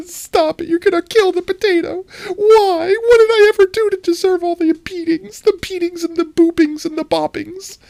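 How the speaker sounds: very high squealed pleading; breathless, mock-pained high whine; out of breath laughing. Real, not acted. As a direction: beg for mercy while cracking up. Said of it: "my first deep breath".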